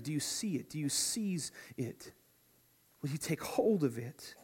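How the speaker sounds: hushed whispered questions; soft whispered challenge. Real, not acted. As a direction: lean in and whisper it. Preacher drops to a hushed aside.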